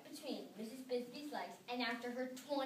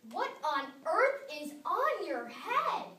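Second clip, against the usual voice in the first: loud, slow, higher outraged shout; 5 semitones higher, 7 dB louder, slower than usual. A narrator's reading. Missus McSnoot shouting at the hat, loud and slow. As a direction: shout the question, drawing it out. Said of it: "She always shouted everything".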